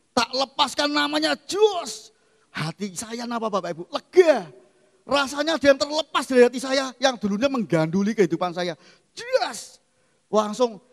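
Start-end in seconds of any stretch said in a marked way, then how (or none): 1.5-2.1 high, bright exclamation jos; joyful cry of relief; excited release. Real, not acted. 3.9-4.5 higher, louder cry of lega; relieved exclamation; relief. Real, not acted. 9.1-9.8 high exclamation jos; pleased little cry; excited release. Real, not acted.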